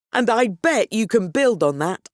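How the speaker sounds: ordinary talk, nothing marked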